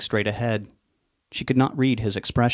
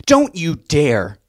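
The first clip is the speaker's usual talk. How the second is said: higher, louder, slow furious yell; 6 semitones higher, 7 dB louder, much slower than usual. Acted. Mother yells at Sue not to speak, furious. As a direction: stop her cold with a yell. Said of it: "Veronica yelled".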